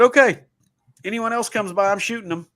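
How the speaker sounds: neighbor's threat. Retold or imitated. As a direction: play the big neighbor cheerfully promising to shoot. Neighbor voiced in retold story.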